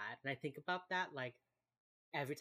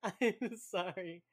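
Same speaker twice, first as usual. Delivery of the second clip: sorry said while still giggling; laughing apology; 8 semitones higher, 6 dB louder, slower than usual. Real, not acted. To her cohost after a sad tangent, laughing apology. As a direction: apologize through real laughter, stretched out.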